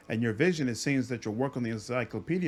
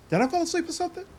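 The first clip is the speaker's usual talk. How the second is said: surprised; 13 semitones higher, faster than usual. Acted. Waking from illusion, baffled.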